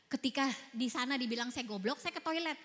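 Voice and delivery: usual speaking voice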